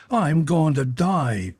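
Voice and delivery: a narrated wail of doom. A narrator's reading. James ending his prophecy of death, a mild wail. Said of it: "he ended in a wail".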